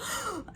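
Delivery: sharp high gasp on waking; startled gasp. Retold or imitated. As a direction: gasp as if jolted awake. Waking from the dream.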